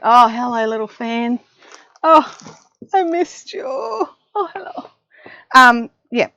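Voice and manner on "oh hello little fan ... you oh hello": cooing to the fan. Real, not acted. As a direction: greet it like a missed pet. Greeting the fan tenderly.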